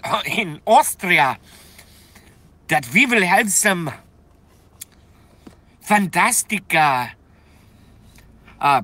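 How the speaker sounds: badly faked Austrian accent, halting between words; terrible Austrian accent. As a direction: ham up a bad Austrian accent, stretching the food words. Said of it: "Terrible accent".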